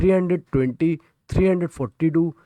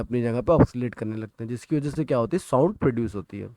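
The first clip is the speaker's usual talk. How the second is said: usual speaking voice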